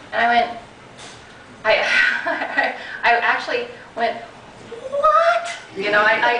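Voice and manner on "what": sudden high shocked yell of disbelief. Retold or imitated. Yelling into the phone in shock at the official. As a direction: blurt it out high and loud, stunned. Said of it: "I actually yelled".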